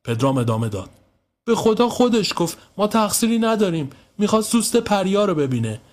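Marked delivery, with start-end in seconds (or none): 1.5-5.8 high-pitched self-defence; boy anxiously swearing it's not his fault; pleading excuse. Acted. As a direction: plead innocence to a cross grown-up. Boy swears they are blameless.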